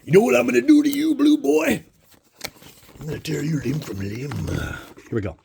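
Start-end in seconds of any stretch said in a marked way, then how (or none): 0.0-4.3 hammed-up menacing high taunt, fast at first; mock villain taunt. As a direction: play a cartoon bad guy threatening the blue card.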